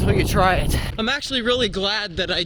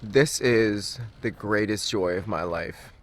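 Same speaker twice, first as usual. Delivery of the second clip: low, slow and quiet deadpan; understated, low sarcastic grumble; deadpan irony; 10 semitones lower, 6 dB quieter than usual. Real, not acted. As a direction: mumble it drily, dragging the words. Ironic aside while running early.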